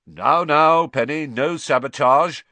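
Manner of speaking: loud, high, jovial teasing; hearty. Acted. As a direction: boom it out cheerfully. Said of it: "said Percy heartily".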